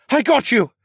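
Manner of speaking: high, loud cry of effort; straining, urgent call; strained shout. Acted. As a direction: shout while hauling someone up. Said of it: "strained to pull me up".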